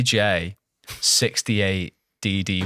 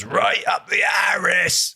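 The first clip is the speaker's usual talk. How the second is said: mock shout of a game soldier, high and slow; lightly hammed-up war cry quote; imitating game soldier; 9 semitones higher, slower than usual. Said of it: "He goes".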